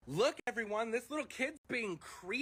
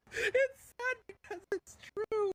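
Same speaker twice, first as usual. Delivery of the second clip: real high laughing, words squeezed out slowly; 13 semitones higher, 3 dB louder, slower than usual. Real, not acted. Laughing at his own joke about followers, real laughing. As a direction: crack up while forcing out the line.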